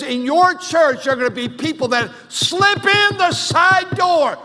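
booming pulpit warning; shouted warning. Acted. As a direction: cry it out as a warning to the church. Preacher voices God's warning.

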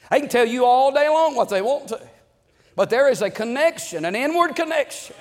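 fervent preaching. Acted. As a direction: raise the voice and drive the point home to the church. Preaching builds up.

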